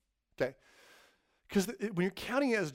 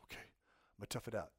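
grim resignation; 7 semitones lower, 13 dB quieter than usual. Retold or imitated. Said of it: "So you go".

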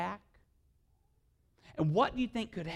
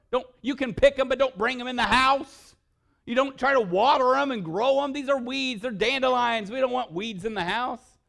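much higher, louder hammed-up lecture; mock scolding dad; 11 semitones higher, 12 dB louder than usual. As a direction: rant at the girls in mock outrage. Dad scolds daughters over dandelions.

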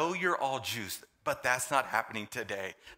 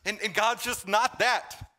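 preaching; 7 semitones higher, 4 dB louder than usual. Acted. Preaching at full force.

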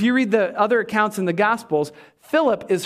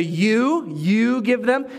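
emphatic "you", repeated for weight; "you" stressed as the point of the phrase; 3 semitones higher, much slower than usual. Shown as a demonstration. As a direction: lean firmly on the word "you". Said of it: "that's also emphatic in the Greek".

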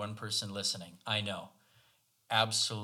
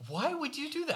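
laughing, squeaky high imitation of his wife; laughing high-voiced parody of the wife; mock high-pitched wife. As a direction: mimic her in a much higher, laughing voice. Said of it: "something along the lines of".

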